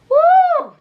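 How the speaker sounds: high, loud, drawn-out "woo"; sustained high exclamation of sympathy; pained whoop. Real, not acted. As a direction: whoop as if the blow landed. Reacts to a painful scene.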